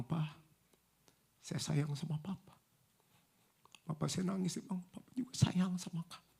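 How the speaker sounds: retold intimate confession, nearly whispered at the end; tender, hushed. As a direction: say it softly with long pauses, as in an embrace. Retelling tender embrace with father.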